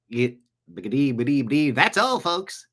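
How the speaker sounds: imitated Mel Blanc-style cartoon character; cartoon voice imitation. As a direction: stammer into a cartoon farewell. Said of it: "Mel Blanc did all those voices".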